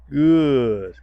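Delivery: big, loud "Good" falling off; loud praise. Real, not acted. Trainer praising the dog for lying down, loud. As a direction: reward the dog with a loud cheer.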